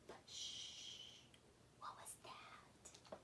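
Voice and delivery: hushed whisper, building suspense; suspenseful whisper. A narrator's reading. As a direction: whisper it to make the kids lean in. Suspense whisper in bedtime story.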